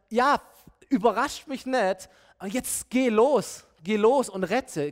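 urgent command. Acted. God sends Gideon off to rescue, emphatic command. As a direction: drive him forward with drawn-out emphasis.

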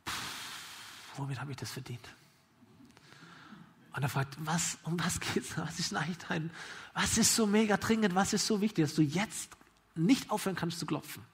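quiet, weary sigh and question; resigned hushed grumble. Acted. Father giving in to the knocking friend, hushed grumble. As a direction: grumble under the breath. Grumpy man asks in hush.

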